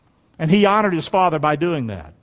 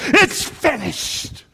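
high, stretched-out proclamation; triumphant announcement; 6 semitones higher, much slower than usual. Acted. Preacher as Jesus telling Dad the work is done, triumphant. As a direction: draw it out high in victory.

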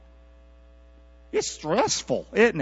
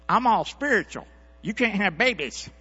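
higher-pitched mock taunt; Peninnah; 6 semitones higher than usual. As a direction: imitate a smug rival needling another woman. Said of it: "That's what Peninnah was doing".

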